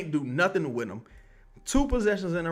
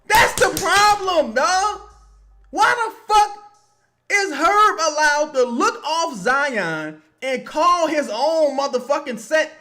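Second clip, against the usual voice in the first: high, loud, drawn-out angry shouting; real exasperated outburst; 10 semitones higher, 9 dB louder, slower than usual. Real, not acted. Fan yelling at Herb for looking off Zion, exasperated outburst. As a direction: yell it in disbelief at the play.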